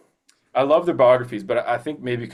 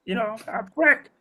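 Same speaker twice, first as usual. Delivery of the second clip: high-pitched character impression; put-on movie character voice; Frank Pantangeli; 14 semitones higher than usual. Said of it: "I don't know if that's a good impression".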